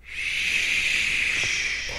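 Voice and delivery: a whispered shush, imitating mom calming an angry child; mom's shush. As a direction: breathe out a soft hushing shh like a mother. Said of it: "she would go".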